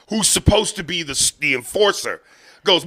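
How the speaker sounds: usual speaking voice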